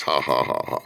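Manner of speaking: low, deliberate "ha ha ha"; dry, spaced-out fake laughter. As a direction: spell out a mock laugh. Mock laugh at joke flower.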